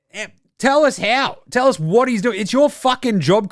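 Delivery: high-pitched indignant demands; exasperated rant. Real, not acted. Host ranting at a TV pundit to do his job, exasperated.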